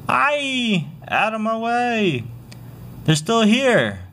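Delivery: high, slow panicked scream, far off. Acted. Fleeing beachgoer screams at the crowd, in high panic. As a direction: flee screaming out of the way.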